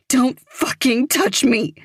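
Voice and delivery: high, loud tearful scream. A narrator's reading. Evie backing away from Claire, high loud scream. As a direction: scream it to make her back off. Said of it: "she screams".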